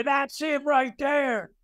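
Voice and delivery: slow, called-out accusation; pointing someone out to the cops; accusing shout. Retold or imitated. Thief returns pointing him out.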